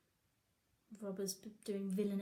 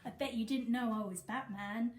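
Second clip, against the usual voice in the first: ordinary delivery, nothing marked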